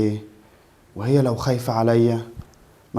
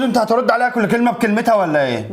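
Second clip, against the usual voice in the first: higher, fast, harsh repeated order; angry demand; 9 semitones higher than usual. Acted. Husband demanding his wife's phone, angry and higher. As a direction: snap the order at her a second time. Husband angrily scolds wife.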